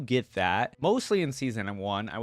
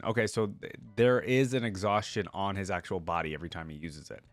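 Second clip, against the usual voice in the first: normal talking voice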